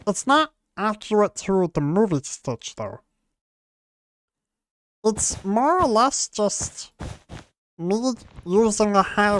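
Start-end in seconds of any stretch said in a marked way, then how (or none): none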